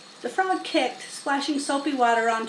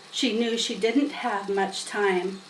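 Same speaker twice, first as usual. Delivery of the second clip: usual speaking voice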